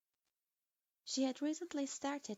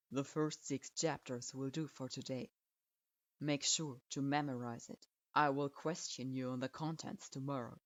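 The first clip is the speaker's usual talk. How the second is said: put-on low, curt old teacher grading a student; a grudging low verdict; stern sensei voice; 8 semitones lower than usual. A narrator's reading. Strict sensei grudgingly approving his student, low and curt.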